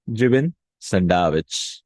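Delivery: jibin sandwich stretched out, jibin stressed; slow model phrase. Shown as a demonstration. Teacher repeating jibin sandwich slowly for learners. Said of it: "translate jibin first".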